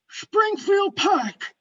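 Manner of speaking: high, slow, stretched echo; drawn-out questioning in a put-on voice; incredulous caller. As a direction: repeat it slowly in loud disbelief. Imitated caller echoes the address.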